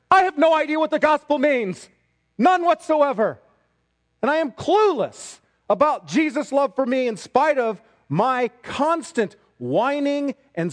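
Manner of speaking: loud, high preacher's mock confession; mocking proclamation. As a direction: proclaim it emphatically, like an absurd sign. Reads out an imagined mocking sign.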